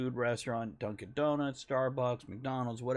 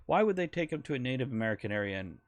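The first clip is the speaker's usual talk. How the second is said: usual speaking voice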